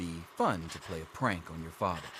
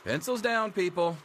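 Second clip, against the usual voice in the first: high, louder call to end the test; calling out to the class; 9 semitones higher, 5 dB louder than usual. Acted. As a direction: announce time's up across the classroom. Teacher calls time on the test.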